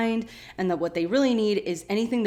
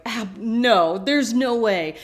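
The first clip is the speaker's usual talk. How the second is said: tag refusal; 3 semitones higher, 6 dB louder, slower than usual. Retold or imitated. Acting out Hurley's refusal.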